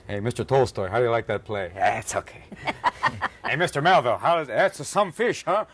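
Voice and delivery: high, loud cocktail-party schmoozer; hammed-up gushing to famous writers; mock schmoozing host. Host gushing at Tolstoy and Melville, hammed-up schmoozing.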